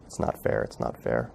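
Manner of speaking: whispered anguish, voice dropped low; retold anguish. Boy alone in his room after the news, whispered anguish. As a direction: breathe it out like a boy choking back tears.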